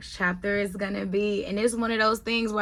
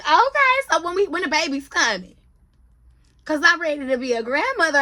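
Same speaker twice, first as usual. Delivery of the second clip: excited mom, voice jumping high and loud; mother-in-law can't wait for a grandbaby, excited high voice; eager mom; 6 semitones higher, 4 dB louder than usual. Retold or imitated. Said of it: "And she was like".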